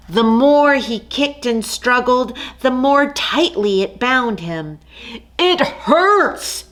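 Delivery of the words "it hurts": higher cry of pain; trapped and hurting; lion crying out in the net. Acted. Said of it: "he cried".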